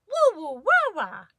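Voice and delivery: put-on high, loud baby babbling; baby sister copying the others in babble. A narrator's reading. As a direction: babble like a baby trying to talk. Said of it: "because she's a baby".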